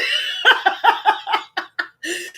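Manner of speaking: high cackling laugh; helpless rolling laughter; uncontained laughter. Real, not acted. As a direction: let the laugh spill out. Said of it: "I don't know how to contain".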